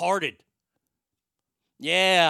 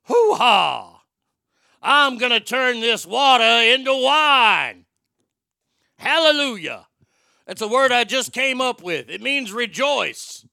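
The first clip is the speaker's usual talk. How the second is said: loud, slow, booming Pacino bluster; Al Pacino as Jesus; 6 semitones higher, 9 dB louder, slower than usual. Acted. Casting Pacino as Jesus, a hammed-up booming impression. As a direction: ham up a roaring Pacino playing Jesus. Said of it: "Pacino will be Jesus".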